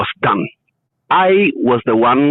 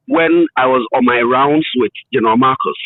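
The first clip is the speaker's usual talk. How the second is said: normal talking voice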